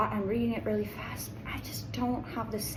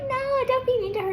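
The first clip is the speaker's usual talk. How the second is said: much higher and louder, protesting; pleading protest; 13 semitones higher, 6 dB louder than usual. Retold or imitated. Reader quoting her own reaction to the characters, loud protest. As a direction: cry out to defend her. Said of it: "And I'm like".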